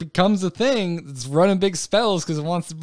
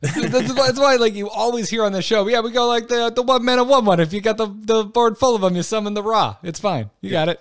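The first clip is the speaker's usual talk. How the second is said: a hammed-up Jersey accent, higher and louder; mock New Jersey accent, higher and louder; bad Jersey accent; 8 semitones higher, 3 dB louder than usual. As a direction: play a loud Jersey guy at a show, badly. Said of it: "a really bad Jersey accent".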